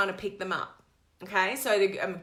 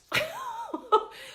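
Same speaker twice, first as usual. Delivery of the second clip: high burst of laughter. Real, not acted. Laughs at her own story.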